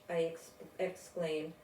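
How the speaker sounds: usual speaking voice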